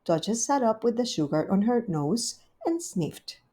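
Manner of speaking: plain reading voice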